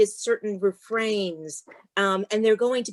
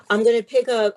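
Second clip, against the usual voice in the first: usual speaking voice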